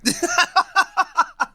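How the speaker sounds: sudden high burst of laughter. Real, not acted. Laughing at the joke.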